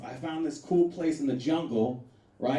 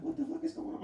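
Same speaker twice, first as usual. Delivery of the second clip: high, soft bewildered question; puzzled and a bit scared; confused alarm; 12 semitones higher, 9 dB quieter than usual. Retold or imitated. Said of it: "So I was like".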